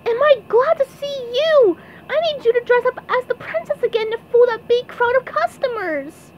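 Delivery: high, loud, eager cartoon voice; SpongeBob voice. A narrator's reading. SpongeBob recruiting Patrick to play princess, high eager plea. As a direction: plead excitedly, very high.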